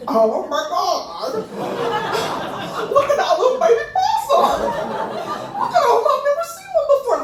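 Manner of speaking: high-pitched squeal of delight; enchanted. Retold or imitated. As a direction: gush over something adorable. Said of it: "I'm enchanted".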